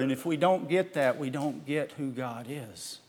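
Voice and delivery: ordinary talk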